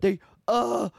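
high mock groan of the twins; mock tonsil-trouble twin. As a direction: imitate swollen tonsils, high. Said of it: "their tonsils were fucked up".

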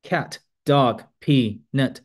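cat、dog、pee、net each said as a separate falling word, like a fourth tone; each word stressed. Shown as a demonstration. Teacher shows how a learner reads cat、dog、pee、net word by word. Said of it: "四声，对不对？".

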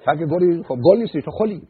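loud, high-pitched exasperated retort; mock scolding. Therapist retorts to the self-styled flower petal, loud mock scolding.

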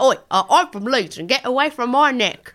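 rough-and-ready mock Leeds accent. Leeds customer warning off the shirt seller, mock Leeds accent. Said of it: "do the accent".

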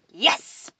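short, loud, eager burst; excited exclamation. A narrator's reading. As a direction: exclaim it in triumph. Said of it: "Alfred exclaimed".

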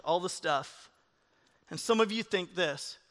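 ordinary talk, nothing marked

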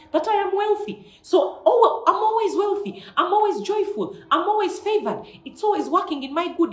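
high, loud joyful confession; exultant faith declaration, pitched high; excited declaration. Acted. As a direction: proclaim it like it's already yours. Preacher declares confessions of wealth.

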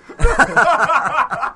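laugh. Real, not acted. Laughs after punchline.

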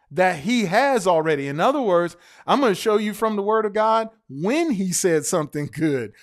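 ordinary talk, nothing marked

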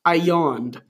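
ordinary talk

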